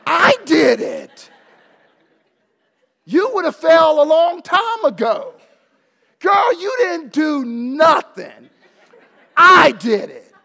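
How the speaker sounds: loud laughing mock protest, high; laughing retort. Dad teasing daughter that he did it, laughing loudly. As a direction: tease her through laughter. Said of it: "I'm sitting here going".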